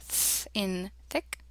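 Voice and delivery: slow, isolated voiceless "th", then "thick"; special TH sound. Shown as a demonstration. Teacher marking the special sound, soft voiceless TH. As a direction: hold "th" as pure breath before "in thick". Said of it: "we have a special sound".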